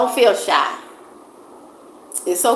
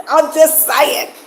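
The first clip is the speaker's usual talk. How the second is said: laughing; 7 semitones higher, slower than usual. Real, not acted. Still laughing after joke.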